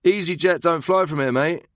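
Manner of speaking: high, loud heckle; loud jeering shout from a distance. A narrator's reading. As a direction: call it out across a crowd. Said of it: "a voice from somewhere on the concourse shouted".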